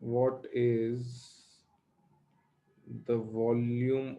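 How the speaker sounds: slow, word by word for copying; slow dictation. Shown as a demonstration. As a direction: dictate it slowly so they can write. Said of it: "लिखो". (धीरे धीरे लिखवाना)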